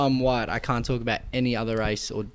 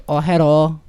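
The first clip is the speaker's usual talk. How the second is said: mock greeting; 4 semitones higher, 9 dB louder than usual. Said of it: "But if I go".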